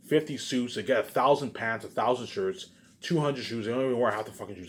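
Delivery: normal talking voice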